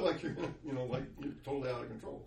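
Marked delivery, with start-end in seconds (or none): none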